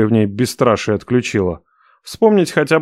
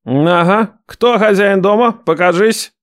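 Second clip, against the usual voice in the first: barked; 9 semitones higher, slower than usual. Acted. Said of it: "гаркнул брат".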